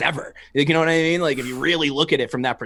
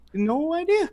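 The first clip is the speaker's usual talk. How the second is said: much higher, squeaky "no idea"; mock clueless squeak; slower than usual. Mocking the guy down the street, high squeak. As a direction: squeak it like an oblivious stranger.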